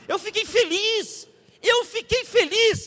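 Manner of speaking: loud, high-pitched joy; overjoyed. Real, not acted. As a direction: proclaim happiness at the top of the range.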